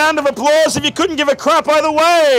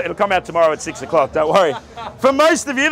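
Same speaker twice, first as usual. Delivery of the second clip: normal talking voice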